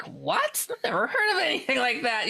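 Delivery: high-pitched retold disbelief; quick, incredulous reaction. Replaying reaction to friend's creature story, incredulous.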